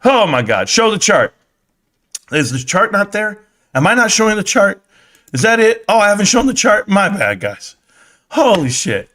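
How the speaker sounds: usual speaking voice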